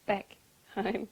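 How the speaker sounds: plain reading voice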